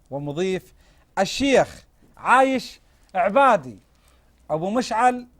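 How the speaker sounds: TV host intro. Acted. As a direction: open the show like a TV host. Host opens the TV episode. (مقدم برنامج تلفزيوني يرحب بالمشاهدين)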